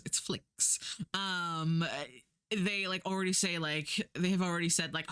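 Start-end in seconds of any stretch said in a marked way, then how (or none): 0.1-0.8 whispered correction; whispered aside. Real, not acted. Speaker correcting her own slip, whispered. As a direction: breathe the word out as a whisper. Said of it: "I always say Netflix".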